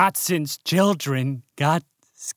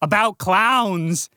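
ordinary talk, nothing marked

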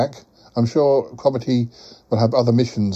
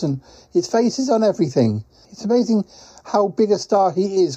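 higher character voice, marvelling at the place; chatty quacking creature; 7 semitones higher than usual. Acted. Quacking traveller tells Paul about talking bears, high chatty creature voice.

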